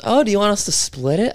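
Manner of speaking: high, naive-sounding offer to split the bill; mock innocent offer. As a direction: pitch it up like you honestly mean to chip in. Friend offers to split huge tab.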